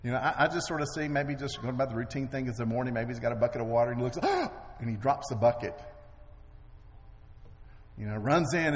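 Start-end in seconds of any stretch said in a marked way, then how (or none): 4.2-4.8 sharp, high gasp of fright; shocked gasp. Retold or imitated. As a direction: suck in air as if you just saw an army. Servant sees army.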